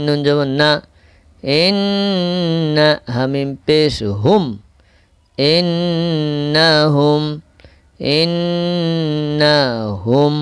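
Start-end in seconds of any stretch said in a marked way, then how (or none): 1.4-2.9 ইন্না with a long hummed n; slow chanted ইন্না, nasal hold; held ghunna. Shown as a demonstration. Teacher demonstrates nasal hold. 5.4-10.4 ইন্নাহুম chanted slowly with a long hummed n; measured tajweed demonstration; nasal-hold recitation. Shown as a demonstration.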